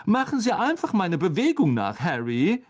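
high, cheery instruction; breezy teacher. A narrator's reading. Teacher telling pupil to copy him, breezy. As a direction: brush off the worry brightly.

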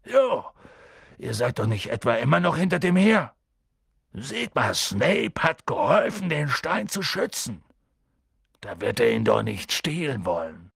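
lightly voiced big gamekeeper, hesitant; a hint of bumbling Hagrid, hemming and hawing. A narrator's reading. Hagrid giving in to the children, lightly voiced.

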